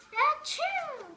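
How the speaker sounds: loud, high achoo; sudden cartoon sneeze. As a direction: let it burst. Sneezing at the doctor.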